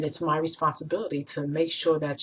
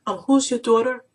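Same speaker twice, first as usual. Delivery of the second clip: higher and louder, playing dumb; mother mimicking the man feigning confusion, higher and louder; mock puzzled man; 7 semitones higher, 7 dB louder than usual. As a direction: ham up his fake confusion. Mother imitates the man's reply.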